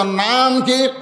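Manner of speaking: ringing question, first word stretched. Shown as a demonstration. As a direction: ask it again forcefully to rouse the crowd. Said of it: "আরো জোরে কর".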